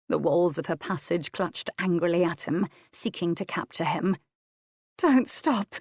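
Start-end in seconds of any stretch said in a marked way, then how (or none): 5.0-5.8 begging. A narrator's reading. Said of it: "she begged shamelessly".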